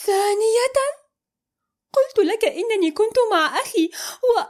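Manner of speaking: high, disbelieving outburst; can't believe he asks again; stunned. A narrator's reading. Amal stunned at being questioned again. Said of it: "قالت ذاهلة".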